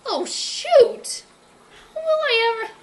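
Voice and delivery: high, loud cry of dismay; dismayed outburst. Acted. Berkeley hearing Bentley was sold, high dismayed cry. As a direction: gasp it out in alarm.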